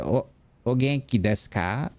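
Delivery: put-on native-style Japanese accent, last vowels cut; copying Japanese teacher. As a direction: imitate the teacher's nasal Japanese pronunciation. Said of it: "copying how she was saying it".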